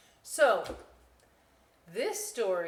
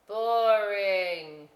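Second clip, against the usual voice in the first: louder flat verdict; loud bored dismissal; 3 semitones higher, 10 dB louder than usual. Acted. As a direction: shut it down. Potato dismisses.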